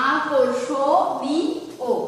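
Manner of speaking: আকর্ষণীয় broken into slow separate syllables; syllable-by-syllable pronunciation. Shown as a demonstration. Teacher showing how to write the pronunciation, syllable by syllable. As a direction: separate every syllable of আকর্ষণীয়, vowels held. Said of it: "যা করছি মুখে".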